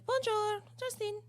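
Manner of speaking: high, lilting mock French phone greeting; fake French greeting. Hostess mocked, answering the phone in fake French. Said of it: "they act like they're French".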